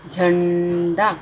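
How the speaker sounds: झंडा drawn out slowly, nasal held; slow sounding out. Shown as a demonstration. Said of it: "झ, अ की मात्रा, ड, आ की मात्रा".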